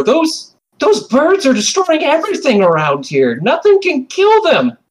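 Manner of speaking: put-on very high, pressing child's voice; boy defends his birds, furious; angry boy. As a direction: insist hard as the boy. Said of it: "He's gonna look at you and go".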